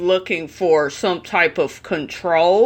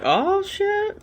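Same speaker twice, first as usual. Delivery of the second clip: a much higher, softer shocked cry; 13 semitones higher, 5 dB quieter than usual. Real, not acted. Commentator hearing the mother yanked the boy, squealing in shock. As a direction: squeal it in disbelief. Hearing she grabbed the kid.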